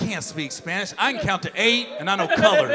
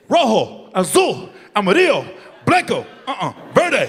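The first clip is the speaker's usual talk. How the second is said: proud recital; 3 semitones higher, 7 dB louder than usual. Retold or imitated. Rattling off Spanish colors proudly.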